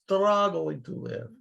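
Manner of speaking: slow, weary Russian lament; Alex's lament. Retold or imitated. Echoing Alex the Russian immigrant, slow and weary. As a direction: drawl it like tired Alex.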